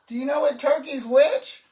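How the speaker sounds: loud call to another room; yelling across the room. Real, not acted. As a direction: shout it up from upstairs. Calling across the house.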